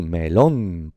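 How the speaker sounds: "melón" stretched slowly, me-LÓÓN; stress on last syllable. Shown as a demonstration. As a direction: lean long and hard on LÓN in "melón". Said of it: "es aguda". ("melón" lento, cargando la fuerza en la última: me-LÓN)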